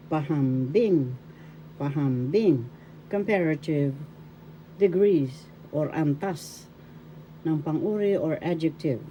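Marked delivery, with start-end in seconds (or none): 0.1-2.6 "Pahambing" said pahamBING, pitch peaking on BING then falling; stress on third syllable. Shown as a demonstration. Teacher modelling it for learners, "Pahambing" accented on "bing". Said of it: "The third syllable has the accent".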